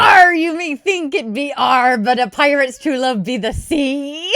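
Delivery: loud, slow, hammed-up pirate. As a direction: growl out the punchline as a pirate. Pirate joke punchline.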